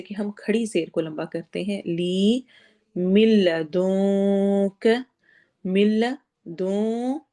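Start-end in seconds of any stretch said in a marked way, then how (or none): 3.0-4.9 ملدونک chanted very slowly, nun hidden and stretched; very slow recitation of ملدونک with hidden nun; hidden nun, lengthened. Shown as a demonstration. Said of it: "نون کو ہم نے چھپا کر لمبا کر کے پڑھنا ہے".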